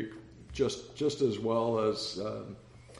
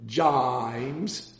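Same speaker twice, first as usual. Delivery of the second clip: Texan drawl. Retold or imitated. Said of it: "trying to translate a Texan".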